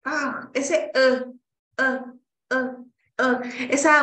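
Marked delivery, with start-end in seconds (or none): 0.9-3.7 "eh" muy corto y apagado; very short schwa. Shown as a demonstration. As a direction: suelta "eh" breve, sin abrir. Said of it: "Es una A muy cortita". (schwa corto, "eh, eh")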